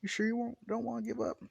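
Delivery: high-pitched teasing question; mock-concerned taunt. Deku taunts the injured Bakugo.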